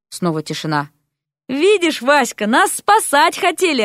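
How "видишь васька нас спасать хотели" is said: high, delighted, drawn-out exclaiming; bright-spirited, raised-pitch glee; joyful exclamation. A narrator's reading. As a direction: crow happily to a friend. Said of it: "радостно воскликнул Дерек".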